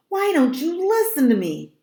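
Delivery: high, loud, exasperated demand; frustrated, high-pitched outburst; angry yell. A narrator's reading. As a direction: shout it in sheer frustration. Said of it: "I let out an angry scream".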